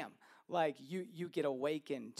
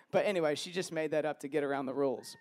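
ordinary delivery

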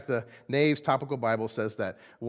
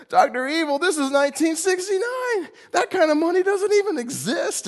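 mocking president; 6 dB louder than usual. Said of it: "He's like".

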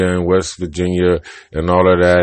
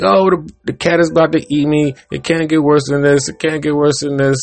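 much higher bird voice, scared of the cat; pitched-up fretting cartoon bird; panicky bird; 7 semitones higher than usual. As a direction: squeak out the fear of being eaten. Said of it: "He's like".

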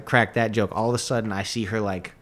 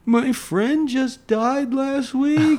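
high, very slow tearful voice; mock sobbing; 13 semitones higher, much slower than usual. The crying girl explaining to the comedian, mock tearful and slow. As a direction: imitate her crying out the words. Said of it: "she's like".

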